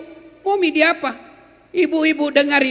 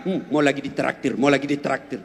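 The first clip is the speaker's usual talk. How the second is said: grumbling inner voice; 13 semitones lower, 5 dB quieter than usual. Retold or imitated. People's inner thoughts voiced.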